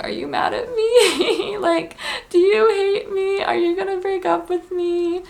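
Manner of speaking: high, teary, half-laughing pleading; mock teary panic. Asking her boyfriend if he's mad, mock teary panic. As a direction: whimper it out like a panicked girlfriend.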